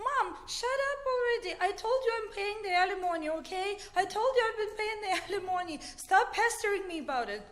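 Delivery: high, quick exasperated rant at a parent; retold angry outburst. Man on the phone to his mom about alimony, angry. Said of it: "he got really angry and he said".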